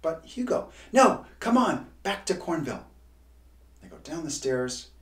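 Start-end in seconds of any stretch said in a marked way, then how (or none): 0.9-2.8 loud, high insistent cry; urgent shout. A narrator's reading. Hugo urging Miles back to Cornville, shouting. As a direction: push him to hurry home.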